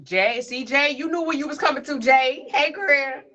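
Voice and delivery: high, loud playful call; excited teasing. Real, not acted. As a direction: tease her loudly and happily. Teasing friend who arrived.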